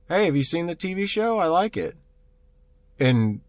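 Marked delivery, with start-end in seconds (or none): 0.1-2.0 much higher, cheery fake-friendly voice; a stranger chirping through the camera; creepy friendly intruder. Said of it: "And he's like".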